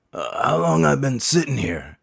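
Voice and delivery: lightly voiced old man, puzzled. Barber imitating confused old man in his chair.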